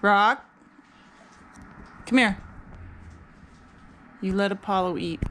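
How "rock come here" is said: louder, firm call to Rock; calling the dog. Real, not acted. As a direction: summon the dog sharply by name. Calling the other dog away.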